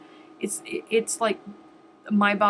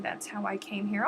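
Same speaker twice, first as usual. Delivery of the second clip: ordinary talk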